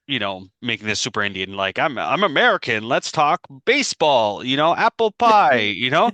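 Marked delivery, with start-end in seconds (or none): 1.8-5.6 higher, louder mock-patriotic pitch; hammed-up all-American. Podcaster imitating shop owner selling himself as American, hammed-up. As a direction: overplay being one of the locals, building higher. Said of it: "being the Indian overlord of".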